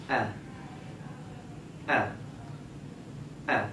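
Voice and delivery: آ kept light and thin, no heavy sound; thin aa, not heavy. Shown as a demonstration. Teacher shows a light آ to the child. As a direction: say آ thin and short, no h at the end. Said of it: "آواز یہاں پہ موٹی نہیں ہونی چاہیے".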